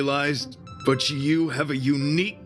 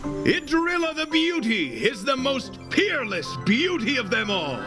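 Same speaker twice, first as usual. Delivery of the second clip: high-pitched knightly proclamation; rallying shout; 10 semitones higher than usual. Acted. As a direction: proclaim it high and ringing, like a battle cry. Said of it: "You will hear my shout".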